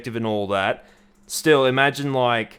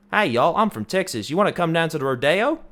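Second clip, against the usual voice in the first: American Southern twang, a little higher; Texan accent; 5 semitones higher than usual. Shown as a demonstration. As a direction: put on a hammed-up Texas accent. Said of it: "I can make an American accent for you".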